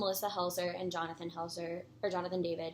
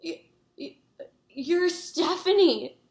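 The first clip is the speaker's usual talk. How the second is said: starstruck stammer; 8 semitones higher, 7 dB louder, slower than usual. Retold or imitated. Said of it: "I go".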